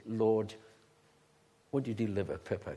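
low, hushed plea to God; gentle prayer. Retold or imitated. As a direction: pray softly and simply. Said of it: "I just said gently".

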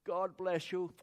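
showman sign-off, loud and high; hammed-up game show farewell; Bruce Forsyth catchphrase. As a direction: belt it out like a TV host. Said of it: "He had a program where he'd end up".

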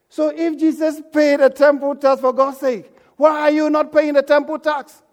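preaching challenge. Real, not acted.

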